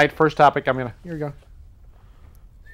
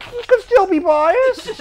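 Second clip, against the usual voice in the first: laughing protest; 6 dB louder, slower than usual. Retold or imitated. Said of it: "And I'm like".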